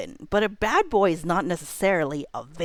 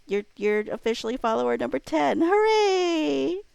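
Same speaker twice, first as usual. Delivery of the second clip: real joy at hitting the follower goal; super excited; 7 semitones higher than usual. Real, not acted. Streamer to new follower, hitting follower goal, squealing with excitement. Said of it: "You made me super excited".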